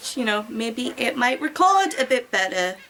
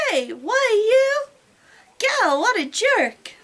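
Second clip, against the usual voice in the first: much higher, slow, scandalized huff; indignant outburst; 9 semitones higher, slower than usual. Acted. Heroine fuming at the rude boyfriend, high scandalized huff. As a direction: splutter at him, offended.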